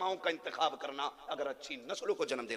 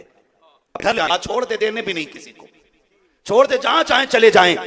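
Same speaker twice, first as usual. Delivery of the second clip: raised, higher voice building to a shout; acted command; 7 semitones higher, 17 dB louder than usual. Preacher voicing Khadija ordering the camels freed, loud command. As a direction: voice a rising, emphatic order.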